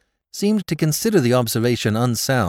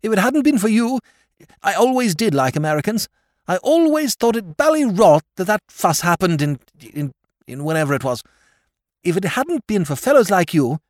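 put-on much higher, louder, aggrieved young lord; self-pitying lord; 10 semitones higher, 4 dB louder than usual. A narrator's reading. Lord Drever lamenting to Sir Thomas, self-pitying. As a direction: wail it in a fervour of self-pity.

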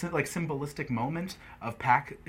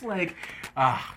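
ordinary talk

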